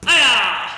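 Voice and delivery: loud sharp kiai yell; full-power kiai shout; martial arts kiai. Real, not acted. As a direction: shout from the gut as you kick. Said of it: "kiai shout on each kick".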